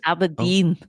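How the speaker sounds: put-on Aberdeen in a light Scottish accent; a hint of a Scottish accent. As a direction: say Aberdeen like a local Scot. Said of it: "In a Scottish accent".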